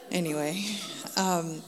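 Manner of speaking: ordinary talk, nothing marked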